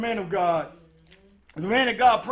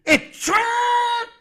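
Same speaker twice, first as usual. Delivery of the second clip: slow, drawn-out, loud preacher build; preaching climax; 7 semitones higher, 8 dB louder, slower than usual. Acted. Preacher to congregation, slow loud sermon build. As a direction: stretch each phrase like a sermon climax.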